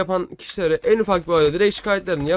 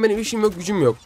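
ordinary talk, nothing marked

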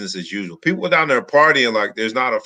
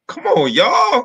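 high, louder whiny plea; exasperated plea; 13 semitones higher, 4 dB louder, slower than usual. Retold or imitated. Co-host mock-pleads with partiers ignoring the pandemic. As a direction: beg partiers to knock it off. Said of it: "I'm like".

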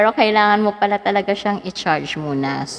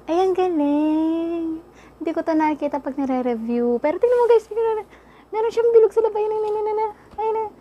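excited; 10 semitones higher than usual. Real, not acted. Unboxing mic, excited high voice.

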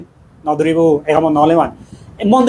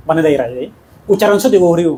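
ordinary delivery

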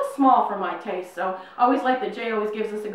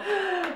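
excited gasp; 8 semitones higher, 5 dB quieter than usual. Real, not acted. Seeing the shirt.

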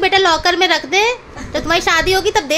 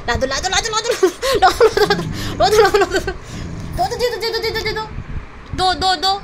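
laughing while coaxing; 3 semitones higher than usual. Real, not acted. Tugging toy from the dog.

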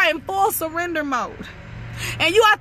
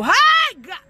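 high, loud hey of praise; ecstatic shout; 6 semitones higher, 4 dB louder than usual. Real, not acted. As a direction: let the praise burst out. Ecstatic outburst in sermon.

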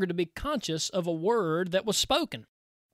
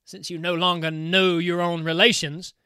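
louder, scornful taunt; mocking uncle spirit; 6 dB louder than usual. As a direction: needle the bride for forgetting her kin. Kuhleborn taunts his niece.

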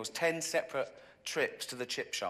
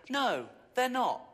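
high, loud exasperated rant; raised, fed-up yell; 8 semitones higher, 4 dB louder than usual. Acted. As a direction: shout it with disbelief. Ranting at fat people.

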